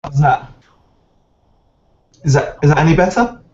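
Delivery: echoey mic, big hall echo; like talking in an empty hall. Real, not acted. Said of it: "you sound very echoey".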